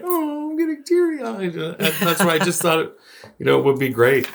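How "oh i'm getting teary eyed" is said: very high, mock-emotional quote; imitating teary friend. Host imitating friend getting teary, very high voice. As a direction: squeak it out like you're about to cry. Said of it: "And you're like".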